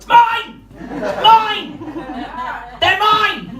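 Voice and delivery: high, loud triumphant yells; shouting mine. Retold or imitated. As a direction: claim them like a toddler with a toy. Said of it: "just whip it out and just go".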